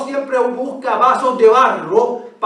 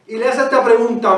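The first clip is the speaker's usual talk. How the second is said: ordinary delivery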